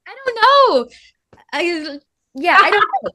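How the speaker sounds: high, loud, laughing squeal of indecision; flustered indecision. Real, not acted. Guest put on the spot for a favorite song, flustered. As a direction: squeal it, stumped and giggly.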